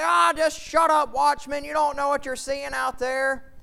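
high, loud brush-off; loud dismissal. Acted. Townspeople to the watchman warning of an army, loud and dismissive. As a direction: shout the watchman down.

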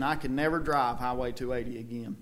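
ordinary delivery

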